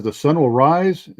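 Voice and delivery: questioning repeat, lifting on "rise"; questioning uncle. Retold or imitated. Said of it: "the uncle says".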